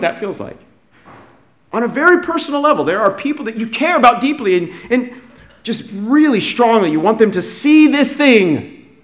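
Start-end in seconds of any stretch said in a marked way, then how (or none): none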